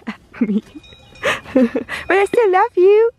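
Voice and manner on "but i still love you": high, slow, tender to the cow; loving the cow. Pretend. Speaker tells the cow she still loves it, tender. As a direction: coo your love at the animal.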